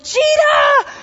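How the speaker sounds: panicked warning yell; gazelle's alarm shout. Retold or imitated. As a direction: cry the warning like a startled gazelle. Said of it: "it's like".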